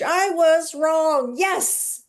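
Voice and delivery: joyful slow confession; celebrating admission. Retold or imitated. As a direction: savour the admission slowly. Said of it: "even that, Anne, is, like, huge".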